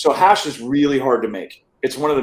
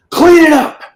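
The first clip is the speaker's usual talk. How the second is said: panicked high scream; 14 semitones higher, 8 dB louder than usual. Retold or imitated. Panicked scream at the girls to hide the weed. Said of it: "and I'm screaming".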